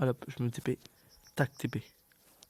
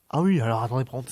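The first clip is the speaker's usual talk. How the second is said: normal talking voice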